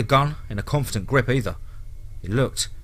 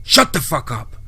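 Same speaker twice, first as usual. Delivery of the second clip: angry; 4 semitones higher, 3 dB louder than usual. Acted. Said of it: "Angry, Brady put the gun".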